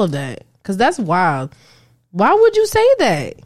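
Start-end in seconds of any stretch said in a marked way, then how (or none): 2.1-3.3 high, slow appalled question. Real, not acted. Friend reacting to the man's caption, appalled. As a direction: ask it in disbelief.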